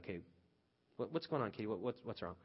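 gentle concern. Retold or imitated. Father asking crying daughter what's wrong, gentle. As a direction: ease her hurt, tender now.

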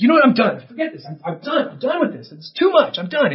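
mock exasperated outburst, pitched high; hammed-up "I'm done" rant; fed-up quitter. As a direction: play someone throwing up their hands. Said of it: "You're like".

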